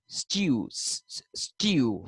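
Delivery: first syllable of student as "styoo"; British y glide. Shown as a demonstration. Teacher isolating the British sound, "styoo" with a y glide. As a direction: break off "styoo" with a y before the oo. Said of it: "the Britishers say".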